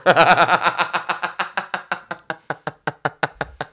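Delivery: long trailing laugh. Real, not acted. Laughing at his own bondage joke. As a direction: laugh till it fades.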